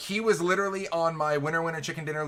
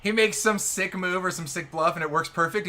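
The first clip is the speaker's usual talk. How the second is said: usual speaking voice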